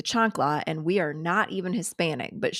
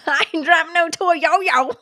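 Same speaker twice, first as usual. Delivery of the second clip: mimicking the clip; 9 semitones higher, 6 dB louder than usual. Streamer echoes a funny clip to viewers, loud high mimicry. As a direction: parrot it loudly, pitched way up.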